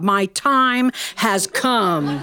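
very slow, portentous delivery; mock-epic declaration, drawn out; mock-solemn resolve. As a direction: stretch each word out for comic gravity. Mock-epic thought at climax.

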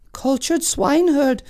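high echoing ladies; lightly voiced court ladies; cartoon princess. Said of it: "echoed the ladies in waiting".

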